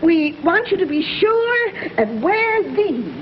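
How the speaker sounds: usual speaking voice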